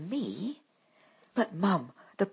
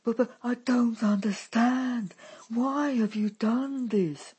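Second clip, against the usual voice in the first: put-on higher, stumbling and slowing bewildered girl; stammering, stupefied; 8 semitones higher, 5 dB louder than usual. A narrator's reading. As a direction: falter through the question. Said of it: "stammers the stupefied Dolly".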